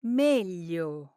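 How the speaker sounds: "Meglio." with a clear gli; careful standard "Meglio.", gli sounded; standard Italian. Shown as a demonstration. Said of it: "the correct pronunciation is?".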